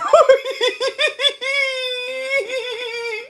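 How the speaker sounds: long high squealing laugh; drawn-out helpless shriek of laughter; crying with laughter. Real, not acted. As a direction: laugh until it becomes a wail. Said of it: "I'm crying".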